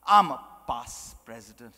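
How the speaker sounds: "past" set apart in a low, self-important intonation; stress on PAST. Acted. Past president declining to help, heavy stress on "past". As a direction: lean grandly on the word "past". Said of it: "Emphasis on past".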